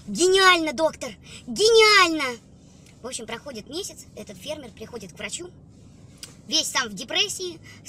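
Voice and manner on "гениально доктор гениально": loud, slow rapturous praise; delighted. Acted. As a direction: gush with loud amazement. Farmer delighted by advice.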